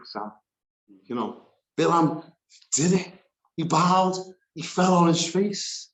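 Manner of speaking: usual speaking voice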